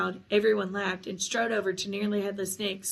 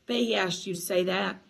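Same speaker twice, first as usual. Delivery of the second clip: plain reading voice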